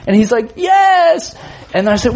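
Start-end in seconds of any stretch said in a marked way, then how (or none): 0.6-1.4 put-on high, urgent kid's answer; desperate child. Son admitting he needs potty, desperate wail. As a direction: imitate a small boy wailing his answer.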